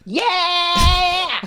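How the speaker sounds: loud, high cheering yeah; excited cheer. Real, not acted. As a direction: cheer it loudly. Cheering the mistake.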